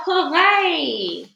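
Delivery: high, loud, drawn-out cheer; triumphant shout. A narrator's reading. Witches celebrate the plan, triumphant loud shout. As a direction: let rip a jubilant cheer. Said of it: "all us witches shout".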